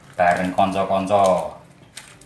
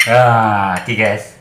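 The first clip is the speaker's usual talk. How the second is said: ordinary talk, nothing marked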